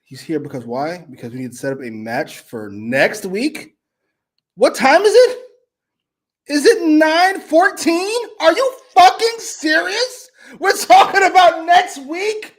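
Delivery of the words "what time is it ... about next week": loud, high, drawn-out outrage; annoyed disbelief. Real, not acted. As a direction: stretch each question out, high and exasperated. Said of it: "I'm so fucking annoyed".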